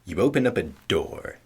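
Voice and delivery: usual speaking voice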